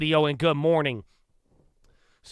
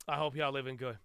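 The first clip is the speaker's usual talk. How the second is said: much softer, held-back voice; hushed greeting; 2 semitones lower, 11 dB quieter than usual. Real, not acted. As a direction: drop right down for a soft greeting. Soft take before repeating it normally.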